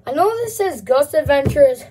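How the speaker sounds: normal talking voice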